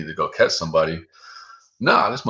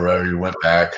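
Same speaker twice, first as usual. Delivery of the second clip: usual speaking voice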